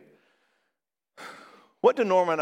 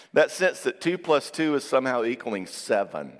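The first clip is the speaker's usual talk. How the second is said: usual speaking voice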